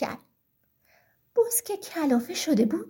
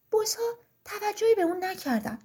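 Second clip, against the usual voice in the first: usual speaking voice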